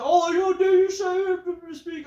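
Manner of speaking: high, loud sneering parody; mock promo voice. Reviewer sneers at the promo, high and loud. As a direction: squeal it out like a cartoon wrestler.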